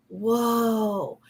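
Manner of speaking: ordinary talk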